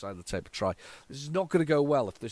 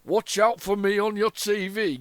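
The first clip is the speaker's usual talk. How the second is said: put-on a light Yorkshire accent, higher and slower; higher, slower hint of Yorkshire; Yorkshire accent advert quote; 6 semitones higher, 4 dB louder than usual. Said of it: "he is from Yorkshire".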